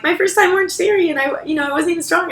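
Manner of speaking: high, lightly imitated newcomer voice; imitating the commenter. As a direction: play the shy newcomer, lightly mocking. Voicing the commenter's story.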